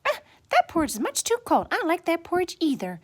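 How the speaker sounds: put-on high, quick little-girl complaint; displeased Goldilocks. A narrator's reading. Goldilocks after trying too-hot porridge, high displeased voice. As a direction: reject it in a fast, high child voice.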